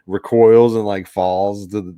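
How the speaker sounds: usual speaking voice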